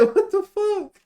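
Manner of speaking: high, loud, laughing through the quote; mock rage cracking into laughter; laughing mock outburst. Streamer retelling the player's rage while laughing, high and loud. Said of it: "He said".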